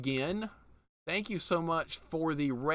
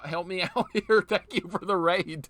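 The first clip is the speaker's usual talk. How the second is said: normal talking voice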